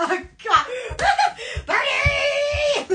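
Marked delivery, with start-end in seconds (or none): 1.7-2.9 calling out. Real, not acted. Excited call out to someone.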